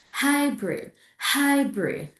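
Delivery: HYBRID with HY high and stressed; first-syllable stress. Shown as a demonstration. Teacher models the third example, stress on HY. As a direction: lift HY in HYBRID, drop -brid. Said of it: "The third one here is hybrid".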